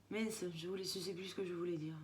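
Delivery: fading into a low mumble; losing the thread, quietly; trailing off. Real, not acted. Forgets what she wanted to say.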